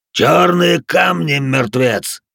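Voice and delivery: loud, slow commanding shout. A narrator's reading. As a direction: bellow it across the field. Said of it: "крикнул он". (громкий крик)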